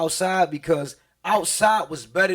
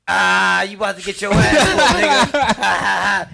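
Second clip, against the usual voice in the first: louder, gleeful mocking laugh; laughing taunt; 3 semitones higher, 7 dB louder, slower than usual. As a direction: gloat and laugh at him.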